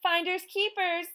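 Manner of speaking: put-on girl turning the tables, calling out; called over shoulder. A narrator's reading. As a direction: fling it back while running. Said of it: "May May called over her shoulder".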